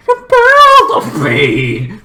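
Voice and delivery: high, loud, drawn-out cry; theatrical horror. Acted. Vlogger announcing the killer's lair, theatrical wail. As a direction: stretch it into a hammy wail.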